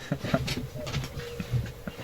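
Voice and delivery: laugh. Real, not acted. Laughing after the mock line.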